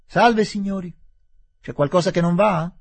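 loud, high mocking hail across the hill; shouted greeting. Aziz hailing the bee-stung Englishmen, loud mocking shout. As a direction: shout it out, cheerfully provoking. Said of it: "e gridò".

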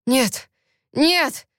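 cries climbing to a high, breaking yell; hoarse yelling. A narrator's reading. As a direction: build until the voice breaks. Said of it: "хрипло заорал он, окончательно срывая голос". (хриплый крик, срывая голос)

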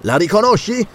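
high, urgent question; sharp demand. A narrator's reading. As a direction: lash out the question. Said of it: "la sua voce sferzò il vento".

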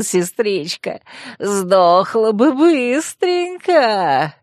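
slow, drawn-out crazed gloating; a mad brother taunting his sister; gloating madman. Acted. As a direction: savor every word like a lunatic. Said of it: "захихикал он".

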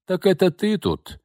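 недовольно; displeased. A narrator's reading. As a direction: sound put out at finding her there. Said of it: "недовольно произнесла она".

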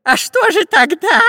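very high, surprised question; astonished spy. A narrator's reading. Spy hearing it may not be gold, astonished. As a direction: squeak it in amazement. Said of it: "удивился шпион Дырка".

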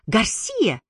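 high, mild exclamation; crying out. A narrator's reading. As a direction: blurt the name accusingly, pitch up. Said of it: "выкрикнула она".

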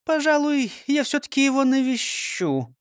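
high prim lady voice; coy, scheming old lady. A narrator's reading. Frøkinstal voice again.

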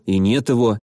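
plain reading voice

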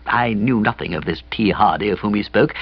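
plain reading voice

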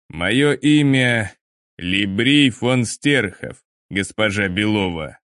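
slow, ringing metallic creature voice. Acted. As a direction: let each word ring like metal. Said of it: "Голос незнакомца прозвенел металлом".

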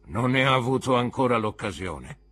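più alto e forte, voce grossa. A narrator's reading. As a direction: rimbomba come un custode rozzo. Uomo dalla grossa voce risponde. (il custode risponde al direttore a gran voce)

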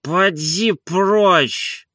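high, very drawn-out dismissal; slow grumbling master's voice; sleepy grumble. A narrator's reading. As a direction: wave the servant off without waking up. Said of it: "проворчал Илья Ильич".

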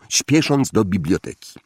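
ordinary delivery

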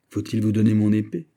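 plain reading voice

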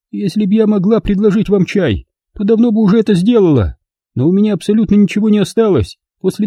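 put-on high, proud elderly noblewoman; old lady voice. A narrator's reading. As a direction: speak as a prim dignified old lady.

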